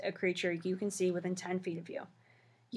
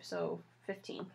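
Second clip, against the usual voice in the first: usual speaking voice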